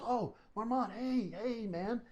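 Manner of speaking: high-pitched imitated greeting; delighted recognition. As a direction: greet an old acquaintance as he did. Imitating Kiefer recognizing him.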